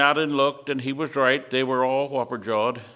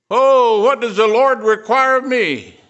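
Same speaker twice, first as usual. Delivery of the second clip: anguished cry; 9 semitones higher, 8 dB louder than usual. Retold or imitated. Confused believers voiced crying out.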